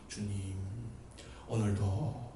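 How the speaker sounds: low, soft, restrained praying. Acted. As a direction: pray discreetly, as most people do. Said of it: "이렇게 하시잖아요, 보통들". (나직하고 조용한 기도 흉내)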